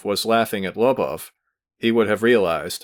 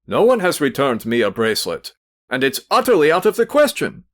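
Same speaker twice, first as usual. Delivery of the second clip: a startled, flustered denial; 5 semitones higher, 4 dB louder than usual. A narrator's reading. Pavel Pavlovich, startled, denies receiving the bracelet. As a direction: caught off guard, deny it louder. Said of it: "Pavel Pavlovich said, startled".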